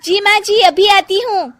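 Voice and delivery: put-on high, eager young voice; obedient young bahu. Janki answering her mother-in-law, high and willing. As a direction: answer sweetly and quickly.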